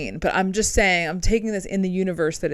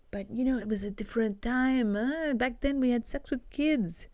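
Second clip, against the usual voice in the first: Roman Polanski in a bad French accent; a deliberately poor French accent, quieter; mock French accent, soft and smug; 5 semitones higher, 5 dB quieter than usual. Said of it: "not even a good French accent".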